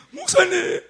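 high, tearful mock cry for the pastor; 울먹이며 부르는 흉내. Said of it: "막 펑펑 우는 거예요". (울음 터뜨리듯 높게 부르는 흉내)

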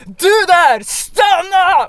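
loud, high bellow to stop someone; roaring shout. Retold or imitated. Paramedic chasing a suspect, bellowing at him. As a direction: yell across the bridge to halt a man. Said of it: "en röst vrålar".

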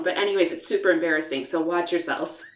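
ordinary delivery

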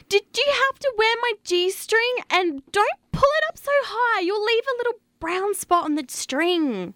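high, fussy woman's voice; high girly voice. Acted. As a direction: fret in a high girly squeak. Wendy upset about G-string.

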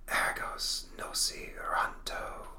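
slow, hushed spellcasting; drawn-out ghostly whisper; whispered incantation. Acted. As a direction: whisper each word slowly as a spell. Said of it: "recites a powerful incantation".

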